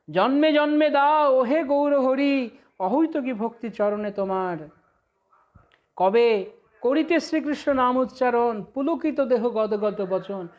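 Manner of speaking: slow, chanted verse; devotional recitation. Acted. Preacher reciting Bhaktivinoda's hymn, slow and chanted. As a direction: intone the hymn lines slowly.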